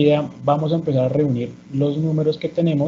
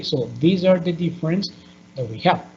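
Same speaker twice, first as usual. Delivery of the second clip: usual speaking voice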